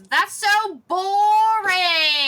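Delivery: put-on slow, drawn-out drunken whine. Tipsy elf whining to the GM that there's no fight, slow drunken whine. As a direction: stretch it out like a bored tipsy girl. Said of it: "still a little drunk ... she goes".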